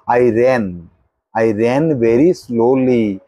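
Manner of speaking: "ran" with the vowel bent back and to one side, sentence dictated slowly; slow dictation, leaning on "ran"; careful pronunciation. Shown as a demonstration. Teacher dictating to pupils, stressing how "ran" sounds. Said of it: "এক পাশে একটু back, বেঁকে যায়".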